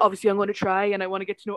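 quick, lightly put-on Welsh accent. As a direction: imitate him in a Welsh accent. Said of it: "sorry to Welsh listeners if this is a terrible Welsh accent".

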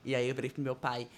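usual speaking voice

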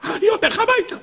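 very high, comic cartoon bear backing off; mocking bear voice. The hunter imagines the bear retreating home, squeaky mock voice. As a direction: squeak it like a scared bear giving up. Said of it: "הוא חושב שהדוב יגיד".

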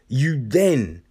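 ordinary delivery

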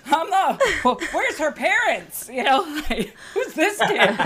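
high, giggling pretend stranger; laughing mock denial. Mother disowning her daughter on the tour, laughing. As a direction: pretend not to know her, laughing through it. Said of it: "I'm like".

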